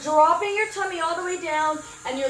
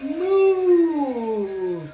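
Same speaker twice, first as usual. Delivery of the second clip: cow moo; 3 dB louder than usual. Shown as a demonstration. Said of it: "what does a cow say?".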